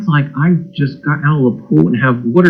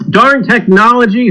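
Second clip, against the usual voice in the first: ordinary delivery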